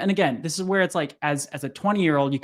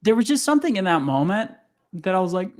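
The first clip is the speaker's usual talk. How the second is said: ordinary delivery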